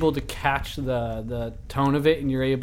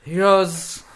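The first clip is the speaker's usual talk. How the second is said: loud; 5 semitones higher, 6 dB louder than usual. Acted. GM bursts into NPC voice.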